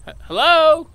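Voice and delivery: high, loud stammering call. Acted. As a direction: call out from up in a tree. Man calls from up a tree.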